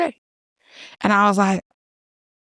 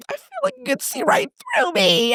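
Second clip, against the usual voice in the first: emotional woman; 12 semitones higher than usual. Retold or imitated. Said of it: "she goes".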